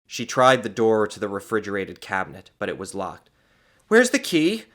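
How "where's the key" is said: high, loud demand; angry. A narrator's reading. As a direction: demand it impatiently. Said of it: "she asked angrily".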